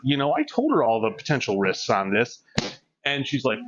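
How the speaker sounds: usual speaking voice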